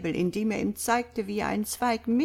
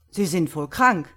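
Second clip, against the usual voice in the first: loud, cutting retort; sharp; 8 dB louder than usual. A narrator's reading. Doctor rebuking the priest's odd answer, sharp. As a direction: snap it with force. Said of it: "meinte Doktor Simon scharf".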